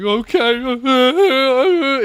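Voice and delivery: high, loud bawled okay; mock hysterics. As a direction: imitate someone sobbing uncontrollably. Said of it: "He's, like, fucking in hysterics".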